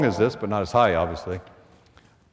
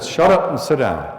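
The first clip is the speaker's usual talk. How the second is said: retold command; 3 semitones higher, 6 dB louder than usual. Ordering the one holdout.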